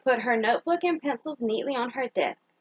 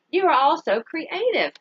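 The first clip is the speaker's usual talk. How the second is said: slow, lifted, louder praise for the class; cheerful teacher praise; 4 semitones higher, 4 dB louder than usual. Acted. Teacher praising her class, slow and bright. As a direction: beam at the class as their proud teacher.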